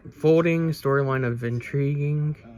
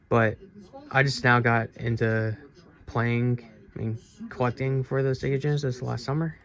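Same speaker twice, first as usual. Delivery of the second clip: normal talking voice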